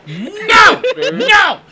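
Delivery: high, loud terrified shriek; panicked scream. Acted. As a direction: scream like you've seen a dragon. He's like.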